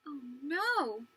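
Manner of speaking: gasp, then a drawn-out, swooping cry; dismayed gasp. Acted. Reader learning the hen is missing, dismayed gasp. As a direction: react with breathless dismay.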